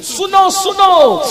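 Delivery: high, loud, drawn-out call to listen; slow, ringing call from the pulpit. Real, not acted. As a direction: stretch out a loud summons to the crowd. Preacher calls crowd to listen.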